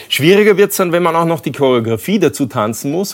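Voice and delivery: ordinary delivery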